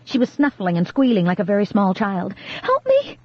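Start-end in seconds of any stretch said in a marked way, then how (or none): 2.6-3.2 much higher shrill cry for help; panicked child's shriek; terrified plea. A narrator's reading. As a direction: scream it in panic. Girl terrified of spiders.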